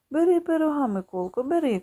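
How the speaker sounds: insistent aunt. Acted. Aunt urging the boy to take pie, loud and insistent. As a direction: push the pie on him. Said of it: "каже дядина".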